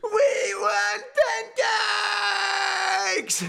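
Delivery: high, drawn-out kiddie demand; long squealed cheer; child's yell. Acted. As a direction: holler it like an eager child. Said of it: "would yell out".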